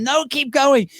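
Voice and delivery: high insistent plea; imitated urging. Fellow panelists refuse to let the performer stop, insistent urging.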